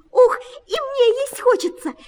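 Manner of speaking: very high mouse squeak. A narrator's reading. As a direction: squeal for food like a little mouse. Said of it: "пищит Верть".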